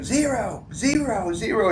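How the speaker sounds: high, soft chanted count; mocking count. Trainees counting push-ups to mock him, soft high chant. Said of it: "start doing".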